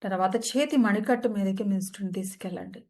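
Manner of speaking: calm, even meditation guide voice; soothing yoga nidra guidance; guided meditation. Acted.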